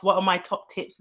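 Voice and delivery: usual speaking voice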